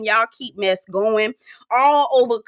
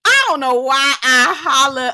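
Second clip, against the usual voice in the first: ordinary delivery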